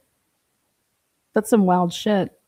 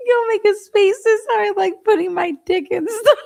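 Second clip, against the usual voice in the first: laughing mock repeat of someone's words; mocking laughter; 3 semitones higher, 3 dB louder than usual. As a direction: parrot it higher, cracking up. Mockingly repeats clip while laughing.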